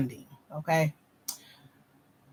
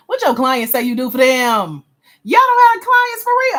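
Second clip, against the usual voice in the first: louder, higher incredulous challenge; loud disbelief; 6 semitones higher, 8 dB louder than usual. Real, not acted. Coach pressing a business owner about their clients, loud disbelief. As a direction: press them, voice shooting up in disbelief.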